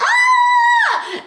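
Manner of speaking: sudden high pained scream. Retold or imitated. Heroine screams high as her hand is caught in the window. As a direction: shriek as her hand gets dragged.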